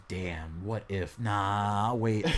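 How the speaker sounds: low, hushed thinking aloud; quiet, low-pitched retold thought; musing to oneself. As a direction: drop low and soft, as if privately weighing it. Retold private thought, tempted, then declining.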